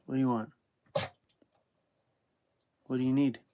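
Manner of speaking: soft teasing coo. Real, not acted. Owner softly coaxing the whining dog. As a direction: hush and tease the whining dog.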